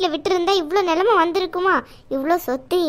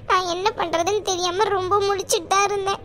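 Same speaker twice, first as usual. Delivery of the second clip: usual speaking voice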